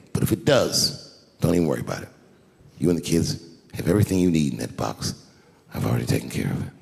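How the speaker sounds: deep, measured husbandly calm; tender reassurance. Retold or imitated. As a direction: soothe a crying wife gently. Husband gently reassures crying wife.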